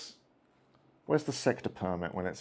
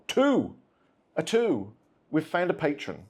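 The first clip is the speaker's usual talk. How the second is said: excited; 5 semitones higher than usual. Real, not acted. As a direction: call out a lucky roll, pitched up. Dice roll succeeds.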